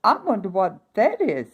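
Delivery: put-on high, breathy-free wondering ooh of a young princess; curious princess. A narrator's reading. Princess finding the spinning wheel, high and wondering. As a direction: lift the voice high and marvel at the strange thing. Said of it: "said the princess".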